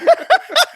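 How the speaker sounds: very high, loud mischievous tittering; imitated kid giggle. Dad imitating his son laughing down the stairs, high giggle. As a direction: titter high like a gleeful little boy. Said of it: "he walked down the stairs like".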